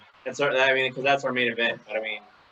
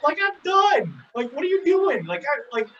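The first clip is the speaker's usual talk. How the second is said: mock exasperation; 11 semitones higher than usual. Imitating a wrestler facing his returning rival, mock exasperation. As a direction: act out a wrestler who can't believe it.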